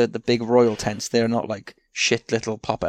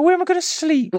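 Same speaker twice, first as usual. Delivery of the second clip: very high, loud whiny king voice; Henry the fourth voice; 6 dB louder than usual. Acted. King complaining about the rain, very high whiny squeal. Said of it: "same Henry the fourth voice".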